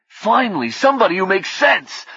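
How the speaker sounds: high, loud exasperated outburst; loud, high angry relief. Acted. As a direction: vent the pent-up frustration. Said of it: "Frederick said angrily".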